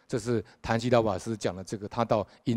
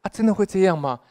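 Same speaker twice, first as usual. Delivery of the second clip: surprised doubt; 7 semitones higher, 6 dB louder than usual. Retold or imitated. Listener doubting the story.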